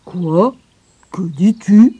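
lightly voiced grandfather, loud and stunned; astonished. A narrator's reading. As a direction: disbelief bursting out.

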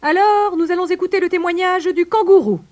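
high, loud pelican judge; loud, high courtroom announcement, first word stretched; announcing. A narrator's reading. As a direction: proclaim it to the whole court. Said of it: "annonça le pélican".

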